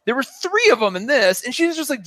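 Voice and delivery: normal talking voice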